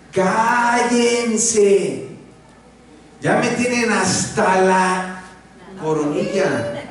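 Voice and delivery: slow, exasperated mock scolding; mock fed-up Moses. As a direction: act out a worn-out leader snapping. Moisés harto, voz actuada. (Moisés harto, regañando en broma)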